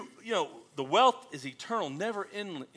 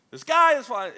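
high, loud panicked squawk; Chicken Little panic; 11 semitones higher, 12 dB louder than usual. Retold or imitated. Chicken Little running around screaming, high and loud. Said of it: "That's all he ever did".

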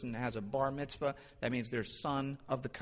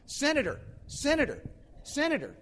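high, falling hail to a passerby; calling out; 12 semitones higher than usual. Retold or imitated. Stranger hails the preacher as senator on the street, calling out high. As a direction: hail someone you pretend to recognize, pitched way up.

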